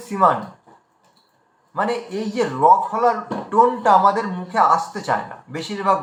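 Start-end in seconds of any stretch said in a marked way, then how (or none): 0.1-0.4 শ্রীমান without the r, as siman; running Bengali pronunciation. Shown as a demonstration. Teacher showing the common Bengali slip, r-less pronunciation. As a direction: drop the র from শ্রীমান. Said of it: "বাঙালিরা এটাকে বেশিরভাগ রানিংয়ে উচ্চারণ করি".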